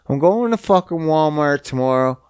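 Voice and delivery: ordinary talk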